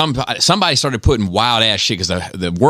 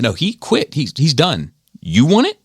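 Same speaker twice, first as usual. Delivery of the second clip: higher-pitched, insistent imitation; retold refusal; 7 semitones higher than usual. Fighter with a code answering onlookers, higher-pitched challenge. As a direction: wave them off, then challenge them. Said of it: "he'd be like".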